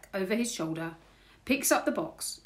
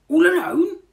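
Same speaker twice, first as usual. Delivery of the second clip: high, loud, drawn question; astonished burglar; 11 semitones higher, 15 dB louder than usual. A narrator's reading. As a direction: exclaim it in disbelief. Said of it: "says Burglar Bill".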